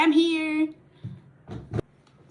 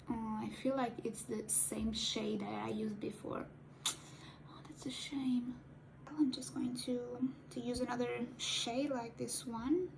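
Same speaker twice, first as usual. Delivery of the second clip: hushed, unvoiced whisper to herself; whispered aside; 9 dB quieter than usual. Real, not acted. Noticing the same eyeshadow shade, a disappointed whisper. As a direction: murmur it under your breath. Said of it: "whispered aside".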